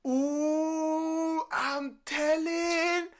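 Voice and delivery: high, slow sing-song taunt; mocking snitching girl, very high and slow; tattletale girl. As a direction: stretch out the tattle like a child. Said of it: "she's like".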